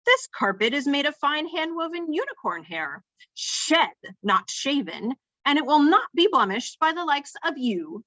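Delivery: high, swooping, haughty diction; snooty. A narrator's reading. Elevator warns trainee about unicorn hair carpet, snooty. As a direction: look down on the visitor with every word. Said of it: "says the elevator in a snooty voice".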